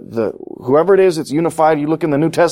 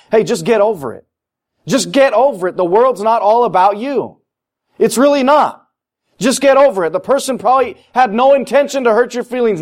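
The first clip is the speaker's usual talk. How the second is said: fiery rebuke; 7 semitones higher than usual. Acted. Pastor scolding grudge-holders in church, fiery rebuke. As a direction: hammer it home. Preaching rebuke at full force.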